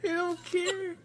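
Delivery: high, laughed-through words; laughing. Real, not acted. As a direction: say it mid-laugh. Laughing while telling the story.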